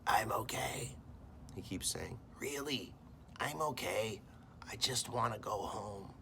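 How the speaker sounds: weak, whispery croak after choking; croaky voice. Acted. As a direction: rasp it out, barely any voice. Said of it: "talk in this croaky voice".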